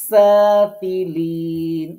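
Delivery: সাফিলিন drawn out slowly with a loud whistling S; সাফিলিন chanted slowly on a level pitch with a hissing S; whistled sibilant. Shown as a demonstration. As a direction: hold the opening S of সাফিলিন like a whistle, then stretch the long vowels. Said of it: "শীষ মতো ইয়ে হবে".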